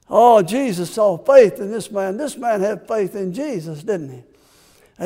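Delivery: usual speaking voice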